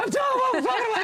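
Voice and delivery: high, laughing squeal of inner panic; laughing inner freakout. Retold or imitated. Comedian squealing about meeting [name], laughing. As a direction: squeal inside while laughing. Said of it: "On the inside, I'm like".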